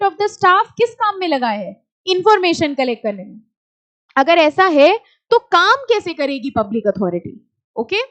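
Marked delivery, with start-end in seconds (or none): none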